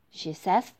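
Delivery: ordinary delivery, nothing marked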